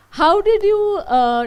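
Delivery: ordinary delivery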